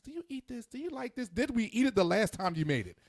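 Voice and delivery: high mock nagging questions; mimicking wife. Husband imitates wife quizzing family at dinner. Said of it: "she will come around and ask us".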